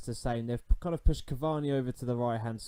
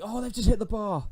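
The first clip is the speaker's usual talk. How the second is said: real panicked yelp, much higher and louder; panicked cry; 10 semitones higher, 7 dB louder than usual. Real, not acted. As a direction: shriek as the ball hits the bar. Rival hits the bar.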